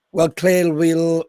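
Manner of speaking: ordinary delivery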